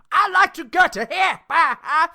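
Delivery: a shrieking, tipsy Chinese exchange student, mocked; mock drunk Chinese student. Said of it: "Chinese female exchange student drunk at a bar".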